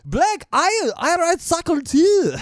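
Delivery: high, loud and slow, drifting from German; a German accent slipping away; bad German accent. Podcaster's German accent falling apart. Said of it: "that's not even German anymore".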